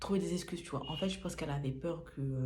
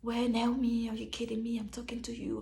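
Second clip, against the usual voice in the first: higher, louder mock yell at Naomi; mock angry yelling; 7 semitones higher, 3 dB louder than usual. Roommate suddenly yelling at Naomi, higher and loud. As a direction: yell at her out of nowhere. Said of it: "elle commence à me crier dessus".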